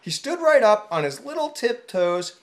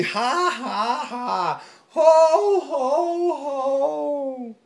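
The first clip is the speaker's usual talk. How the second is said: hearty laugh; 7 semitones higher, 4 dB louder, much slower than usual. Acted. Elephant laughing after giggle.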